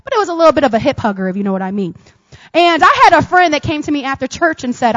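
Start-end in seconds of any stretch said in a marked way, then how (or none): none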